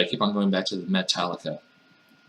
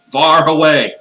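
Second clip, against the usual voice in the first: loud, higher, hammed-up cry; exaggerated shout; 7 semitones higher, 10 dB louder, slower than usual. Master insisting on sleeping well away, exaggerated shout. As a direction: blow it up into a big comic shout. Louder comic repeat.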